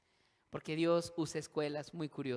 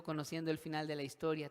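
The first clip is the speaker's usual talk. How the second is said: ordinary delivery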